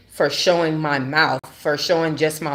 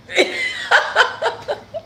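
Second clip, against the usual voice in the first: high laugh. Real, not acted. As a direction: burst into a high cackle. Laughing at own retort.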